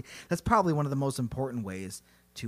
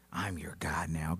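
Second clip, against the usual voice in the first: low, soft, whispery creature voice; hushed mock-sinister declaration; menacing monster; 10 semitones lower, 9 dB quieter than usual. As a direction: whisper it low like an evil overlord. Monster declares itself a god.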